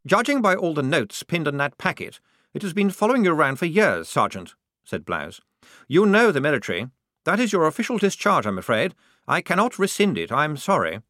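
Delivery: high-pitched, officious officer voice; apologetic lieutenant. A narrator's reading. Lieutenant telling his sergeant the discharge stands, officiously apologetic. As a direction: explain the rules as an insistent young officer.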